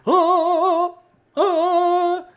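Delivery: very high, loud, sung-out hammed-up scream; Jim Carrey scream. Dad mimics a comedy movie scream to describe daughters. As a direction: belt a cartoonish comedy wail. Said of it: "Jim Carrey in, uh, uh, Ace Ventura".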